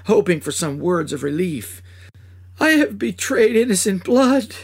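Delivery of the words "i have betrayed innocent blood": higher, louder anguished confession; weeping cry. Acted. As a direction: cry it out in remorse. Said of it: "I cried as I wept aloud".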